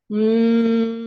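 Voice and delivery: steady loud hum through the mouth; bee breath hum. Shown as a demonstration. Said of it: "you hum through your mouth, like that".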